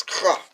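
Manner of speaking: "cha" with a rasped, throaty opening; teacher demonstrating a raspy "cha"; rasping H. Shown as a demonstration. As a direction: rasp "cha" out loudly from the throat. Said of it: "it got like a rasping H sound".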